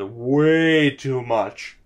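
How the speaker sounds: talking through a yawn; stretched yawning voice. Real, not acted. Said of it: "I can't stop yawning now".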